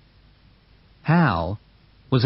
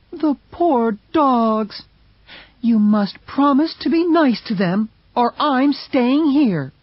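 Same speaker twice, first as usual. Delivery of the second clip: pleading Mercedes; 12 semitones higher than usual. Acted. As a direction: pity the dogs, pitched up.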